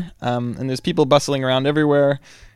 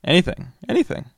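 lightly voiced, higher Italian woman; a hint of her breezy voice; Italian woman's voice; 6 semitones higher than usual. Intercom woman telling him any password works, lightly voiced. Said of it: "female Italian voice".

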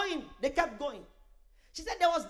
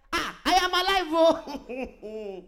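loud, higher cry of being alive; retold exclamation; 3 semitones higher, 8 dB louder, much slower than usual. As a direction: burst out loudly, stretching it into a laugh. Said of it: "she said".

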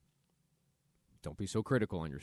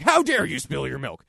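loud, high put-on scolding; hammed-up parent scolding a spilled glass; mock outrage; 6 semitones higher, 12 dB louder than usual. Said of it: "You're like".

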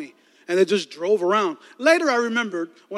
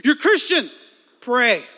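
high, sudden mock order; sharp self-command; 5 semitones higher, 3 dB louder, slower than usual. His inner voice corrects his panicking self, sharp command. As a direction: cut in like a scolding conscience, pitched up.

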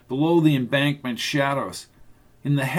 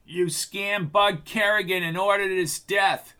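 crying out; 5 semitones higher than usual. A narrator's reading. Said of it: "cried Tucker".

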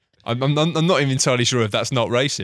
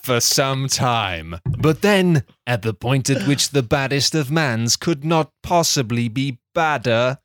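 measured, deadpan storyteller read; deliberate storybook delivery; story narration; 3 dB louder, much slower than usual. A narrator's reading. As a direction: narrate a silly recap like a serious tale. Script narration.

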